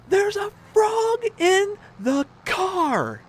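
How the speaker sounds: very high, loud, drawn-out shriek; over-the-top slow-motion wail; hysterical wife. Retold or imitated. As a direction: scream each word slowly as if in mortal danger. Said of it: "I'm making her sound like she's, like, this crazy person".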